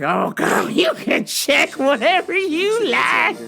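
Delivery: high, stretched-out, giggly invitation; giddy mock flirting. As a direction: gush at her through a laugh. Acts giddy consent to search.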